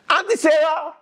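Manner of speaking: high, loud call from a car. Retold or imitated. Driver hailing Auntie Sarah, high shout. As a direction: yell it from a passing car. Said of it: "somebody drive by and say".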